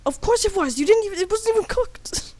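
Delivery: high-pitched protesting character; comic indignant high voice; Japanese man. Acted. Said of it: "the Japanese man says".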